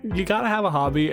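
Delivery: usual speaking voice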